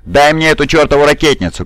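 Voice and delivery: high-pitched, impatient demand; urgent shout. A narrator's reading. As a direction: cry out for the weapon in battle. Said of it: "крикнул Дев". (требовательный крик в бою)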